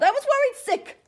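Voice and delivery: loud high scolding; upset yell. Acted. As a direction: shout it, still shaken. Leprechaun scolds returned dog.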